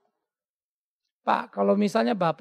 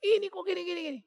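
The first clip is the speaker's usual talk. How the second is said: mock upset; 11 semitones higher, 5 dB quieter, faster than usual. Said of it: "Ada waktu saya emosi".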